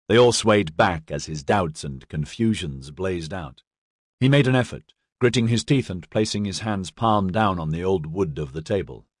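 ordinary delivery, nothing marked

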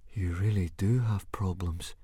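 hushed, whispered amazement; awed voice. A narrator's reading. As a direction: whisper it in awe. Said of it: "he said in an awed voice".

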